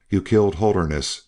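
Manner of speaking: ordinary delivery, nothing marked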